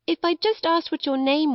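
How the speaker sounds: a hint of a rueful small boy; lightly voiced young boy, regretful. Boy regrets not asking her name, self-reproachful.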